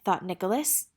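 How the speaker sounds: ordinary delivery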